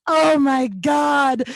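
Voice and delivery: drawn-out, slowed, shocked oh my God; mock horror; horrified gasp. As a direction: let it out slowly, mortified. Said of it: "And I was like".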